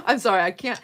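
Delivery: loud, giggly apology; helpless laughter breaking into the words; laughing apology. Real, not acted. Storyteller cracking up at her own impression, laughing.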